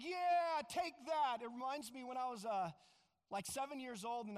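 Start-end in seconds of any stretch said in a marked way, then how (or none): 0.0-1.4 defiant cheer. Retold or imitated. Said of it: "They're like".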